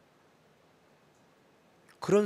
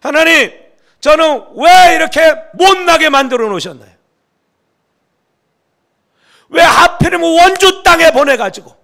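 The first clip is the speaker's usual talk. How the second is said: complaining prayer; 13 semitones higher, 18 dB louder than usual. Retold or imitated. Pastor retelling his own complaint to God, as a loud bitter outcry. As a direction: reenact crying out bitterly to God. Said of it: "제가 막 불평했다니까요".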